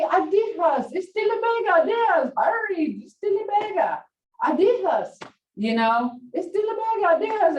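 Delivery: high, insistent repeated urging; urging grandson. Retold or imitated.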